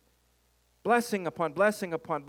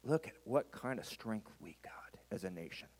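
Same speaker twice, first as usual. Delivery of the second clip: whispered serpent temptation; serpent hiss; 8 semitones lower, 13 dB quieter than usual. Acted. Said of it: "You can hear the hiss of the serpent".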